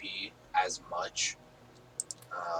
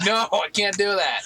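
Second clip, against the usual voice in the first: laughing mock reply; 10 semitones higher, 8 dB louder than usual. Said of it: "It's like".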